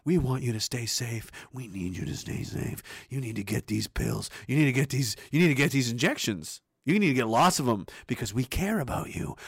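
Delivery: mock-tender government reassurance; fake caring officials. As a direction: coo it like a soothing ad.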